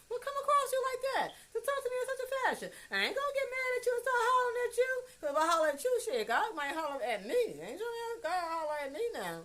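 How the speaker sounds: very high, fast acted complaint; high-pitched protest. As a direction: rattle it off in a squeaky-high voice to mama. Acted remonstrating with mother, high voice.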